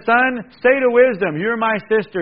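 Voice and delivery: loud, high, emphatic reading of the verse; ringing preacher's call; booming proclamation. Acted. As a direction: proclaim it to the whole room.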